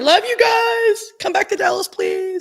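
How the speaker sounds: high, loud, stretched-out shout; calling out. Pretend. Host shouting out to a band, high playful plea. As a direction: holler a playful plea to faraway fans.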